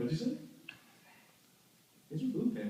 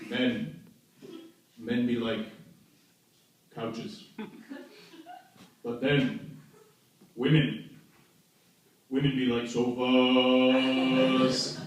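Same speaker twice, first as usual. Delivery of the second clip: low, slow, half-sung delivery; 4 semitones lower, much slower than usual. Acted.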